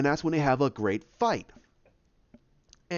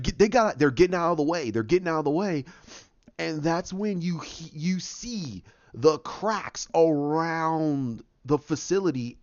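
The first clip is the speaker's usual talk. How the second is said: ordinary talk, nothing marked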